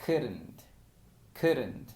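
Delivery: "couldn't" with a flapped, quick d and no L; couldn't demo; couldn't, silent L. Shown as a demonstration. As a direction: say "couldn't" without the L. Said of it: "sonaría como".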